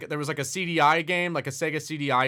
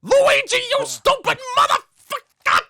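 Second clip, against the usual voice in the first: very high, loud, slow furious shout; hammed-up gangster tirade; Joe Pesci; 7 dB louder, slower than usual. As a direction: scream it like an enraged movie mobster. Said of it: "clips of people editing together his Mario voice lines".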